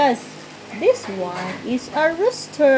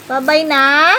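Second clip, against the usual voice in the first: calling out; 6 semitones higher, 6 dB louder than usual. Real, not acted. Calling after wandering child.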